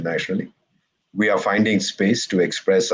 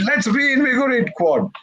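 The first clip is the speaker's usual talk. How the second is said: high, slow rallying cry, pitched way up; mock rousing call. The Aussies coming back to rejoin the Quad, hammed-up rallying cry. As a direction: draw it out like an eager pep rally. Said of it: "they came back and said".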